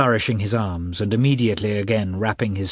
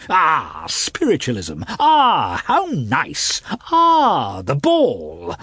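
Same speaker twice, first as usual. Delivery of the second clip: much higher mincing mimicry of a gushing wife; mocking his wife; 6 semitones higher, 4 dB louder, much slower than usual. A narrator's reading. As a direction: ridicule her with a fluttery put-on voice. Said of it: "impersonating his wife".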